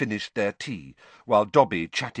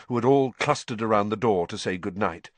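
ordinary delivery, nothing marked

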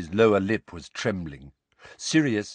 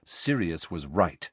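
ordinary delivery, nothing marked

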